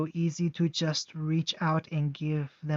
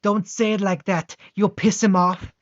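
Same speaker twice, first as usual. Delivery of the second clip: loud, higher alarmed cry; panicked warning; 4 semitones higher, 8 dB louder than usual. Acted. Soldier warning his comrade, loud alarmed shout.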